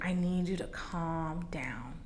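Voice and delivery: slow, whispered calming; soft whisper. Retold or imitated. Godmother calming her after she collapsed, whispered. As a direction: hush her gently, slowly. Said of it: "she's like".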